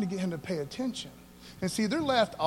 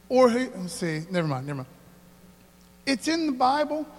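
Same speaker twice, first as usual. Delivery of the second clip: ordinary delivery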